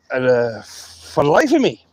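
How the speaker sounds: ordinary talk, nothing marked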